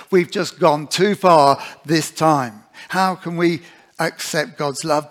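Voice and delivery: full-voiced sermon delivery; preacher urging the church to trust God, preaching. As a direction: preach it out to the congregation. Preaches to the congregation.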